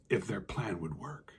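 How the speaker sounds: hushed storyteller whisper. A narrator's reading. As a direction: breathe it out, full of suspense. Worms waiting excitedly.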